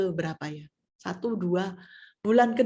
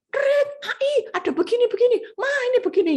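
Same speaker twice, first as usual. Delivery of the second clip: put-on much higher, excited child voices calling out; kids calling out; 14 semitones higher, 4 dB louder, faster than usual. The kids calling to Mama while she works, much higher and excited. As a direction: squeal it like an excited kid calling Mom. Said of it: "yang sana teriak".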